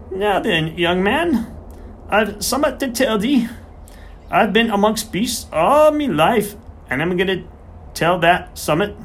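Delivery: put-on high, slowish voice of an old Yorkshire countryman; a garrulous old farmer settling in to talk. Old farmer buttonholes the vet.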